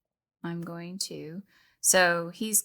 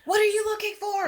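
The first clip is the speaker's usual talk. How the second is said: very high, loud call to the ghost; challenging shout; 5 dB louder than usual. Acted. Bard calling out to the reappearing ghost, high and loud. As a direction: shout it across the room at the spirit. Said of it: "the ghost reappears".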